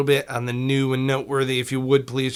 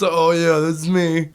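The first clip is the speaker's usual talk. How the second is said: mock mellow guy; 4 semitones higher, 6 dB louder, slower than usual. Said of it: "sitting here mellow as fuck".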